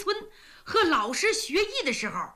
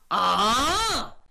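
a sudden loud cry of alarm; startled shout; 2 semitones lower, 3 dB louder than usual. Acted. Drunk general hearing enemy troops arrived, startled shout. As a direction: jolt awake with a yell.

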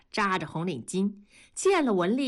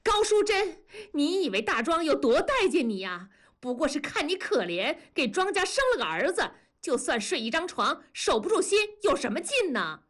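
high-pitched angry tirade; shouting; 9 semitones higher than usual. A narrator's reading. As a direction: lash out at your rival. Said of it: "梅梅推开文丽喊". (高声怒喊)

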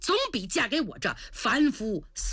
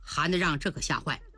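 ordinary delivery, nothing marked

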